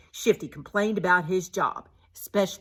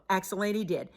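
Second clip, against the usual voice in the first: ordinary delivery, nothing marked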